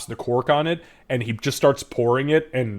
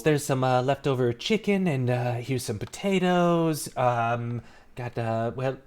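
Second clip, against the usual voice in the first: slow, halting host listing leftovers; lightly voiced dad, pausing between finds; father character. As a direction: rummage as you talk. Father offering leftover food.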